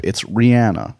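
Rihanna as ree-ANN-uh; corrected pronunciation. Shown as a demonstration. Host telling folks to look it up, [name] said ree-ANN-uh. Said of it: "you can look it up".

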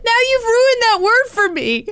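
high, loud, laughing complaint. Real, not acted. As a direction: whine it through laughter.

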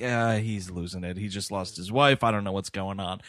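low, quiet confiding murmur; hushed aside. Retold or imitated. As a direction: whisper it to the ladies. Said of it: "He's like".

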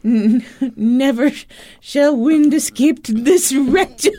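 mock solemn proclamation. Mock solemn vow about farting.

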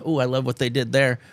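usual speaking voice

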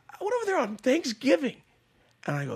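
normal talking voice

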